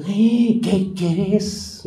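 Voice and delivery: a slow, drawn-out nosy "¿Qué querés?"; mock gossip. Pastor mimics a gossiper for the congregation, drawn out. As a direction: play the eager gossip, stretching it out.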